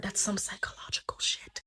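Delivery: hushed whisper to the listener; confiding, voiceless whisper; whispered aside. Real, not acted. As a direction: drop into a whisper for a side comment.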